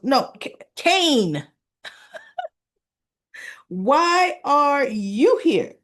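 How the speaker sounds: stunned, half laughing, drawn out slow; shocked disbelief. Retold or imitated. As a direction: blurt it as if you can't believe who's there. Lily shocked to see Kane.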